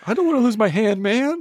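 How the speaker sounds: high, loud panicky whine; mock frightened kid. Kid facing the jarred hand, mock panic. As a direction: wail it in a high voice.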